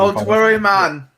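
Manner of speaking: put-on Jamaican lilt; playful mock Jamaican accent. Shown as a demonstration. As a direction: show off a Jamaican accent, teasing. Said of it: "A Jamaican accent is a lot better".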